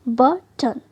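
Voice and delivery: बटन broken as but, then ton; split double consonant. Shown as a demonstration. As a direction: split बटन between its two t's. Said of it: "एक टी को first syllable के साथ". (बटन को बट-टन तोड़कर बोलें)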